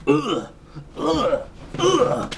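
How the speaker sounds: high mock grunts of being shot; exaggerated high-pitched hit grunts; imitated gunshot reaction. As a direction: act out taking bullets, "ugh" each time. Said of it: "He just reacts to the gunshots like".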